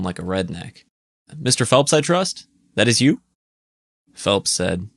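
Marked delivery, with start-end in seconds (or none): 1.3-3.2 put-on Eastern accent. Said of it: "An Eastern accented voice".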